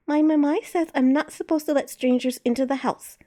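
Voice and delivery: put-on a light child voice; prim little kid reciting a rule; little girl voice. Kid refuses stranger.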